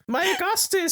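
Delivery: high, wailing mother crying My Augustus; overacted grieving mother; Mrs Gloop. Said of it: "one of them's just like".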